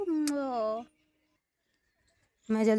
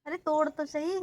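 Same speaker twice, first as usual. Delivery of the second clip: normal talking voice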